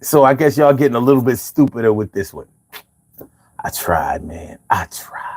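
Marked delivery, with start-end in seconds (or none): 3.6-5.3 whisper. Real, not acted. Mock defeat after reading joke.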